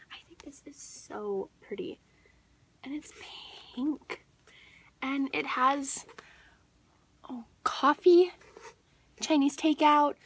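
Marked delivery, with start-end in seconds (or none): 0.1-4.2 unvoiced, hushed admiration; whisper. Real, not acted. Whispering over a pretty book. 7.3-8.3 awed whisper. Real, not acted. Awed at the cover.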